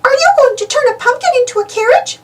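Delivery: high, bright little mouse voice; sweet high-pitched Minnie; Minnie's voice. Acted. Minnie suggesting a story to friends, high mouse voice. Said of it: "Minnie-rella asks".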